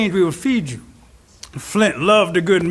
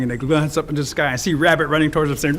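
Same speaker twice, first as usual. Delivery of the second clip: usual speaking voice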